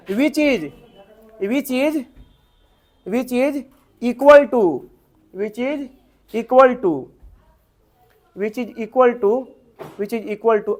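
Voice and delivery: measured pace for people writing; slow dictation. Real, not acted. Teacher repeating the line for students to copy, slow dictation. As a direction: repeat it slowly in short chunks.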